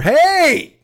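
high, loud shout from a distance; desperate call. Retold or imitated. Trapped man in the stall shouting after him. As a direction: call out before he's left behind.

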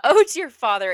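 composed princess retake. Acted. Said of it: "This isn't that funny".

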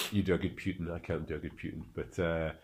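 put-on a half-hearted try at a Russian accent for Putin; hint of a Russian accent, quiet and low-key; bad Putin impression. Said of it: "I think mine sounds like Hitler".

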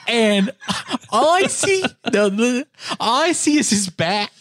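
usual speaking voice

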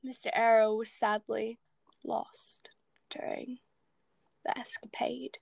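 starts in a normal voice, then fades to a soft whisper; lightly voiced sinister Scroop, trailing off to a whisper; imitating Scroop. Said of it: "And Scroop goes".

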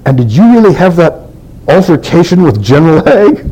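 a light impression of his surprised mother, voice lifted high; imitated mother.

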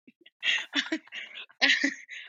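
bursting into a high laugh; loud laugh. Real, not acted. Phone interrupts mid-interview.

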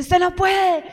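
a loud, high, mocking shout of "you can't"; taunting executioner. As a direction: jeer "Usted no puede" at the runner. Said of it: "el verdugo tenía que decirle".

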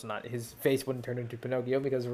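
usual speaking voice

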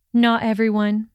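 plain reading voice

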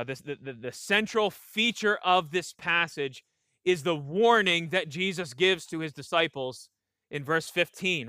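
ordinary talk

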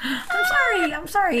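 high-pitched sorry through laughter; laughing apology. Real, not acted. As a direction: apologize while helplessly giggling. Apologizing while cracking up.

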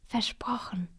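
soft whispered answer, falling; the boy whispers back his promise. Acted. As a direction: swear it solemnly in a whisper. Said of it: "flüstert Tim".

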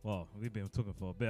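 normal talking voice

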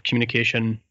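normal talking voice

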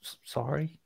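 sheepish mock apology. Host to listeners in Minnesota, soft mock apology. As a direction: offer a tiny, insincere apology.

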